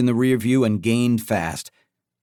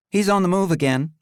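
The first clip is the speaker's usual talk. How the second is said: cool female boss voice; 8 semitones higher, faster than usual. Acted. Blair voiced.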